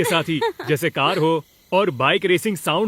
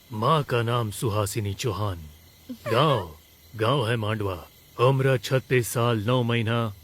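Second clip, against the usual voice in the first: Amitabh Bachchan impression; 7 semitones lower than usual. Shown as a demonstration. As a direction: drop the pitch and space out each phrase with weight. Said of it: "Amitabh Bachchan का सुनाओ". (अमिताभ बच्चन जैसी धीमी, भारी आवाज़)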